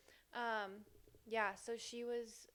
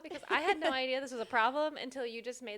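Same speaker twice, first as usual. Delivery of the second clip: mock-annoyed protest, louder and pitched up; 3 semitones higher, 9 dB louder, faster than usual. Reader imagining her reply to the character who pointed out her habit, annoyed. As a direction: complain in playful exasperation, picking up speed. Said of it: "I would have been so annoyed".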